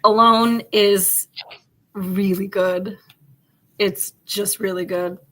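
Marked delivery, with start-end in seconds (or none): 0.0-3.0 slow, with a loud, weighted "Alone"; emphatic praise. Real, not acted. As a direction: press each word, slowly, to sell the show. Insisting the show is great.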